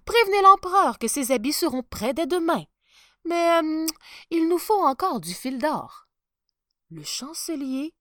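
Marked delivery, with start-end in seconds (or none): 0.1-6.1 much higher, louder eager welcome; sly tailor ushering him in; fawning tailor. Acted. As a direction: greet him with oily charm. Tailor asks more gold.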